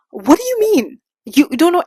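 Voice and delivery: high and loud disbelief; what do you mean, squeaked up high; incredulous. Real, not acted. Ranting to viewers about instant love, incredulous squeak.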